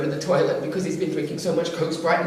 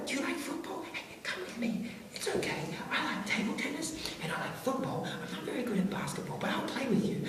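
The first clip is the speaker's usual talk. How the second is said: high, soft, near-whispered coaxing; soothing; 10 semitones higher, 10 dB quieter than usual. Retold or imitated. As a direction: gently reassure a scared child. Comforting the upset kid.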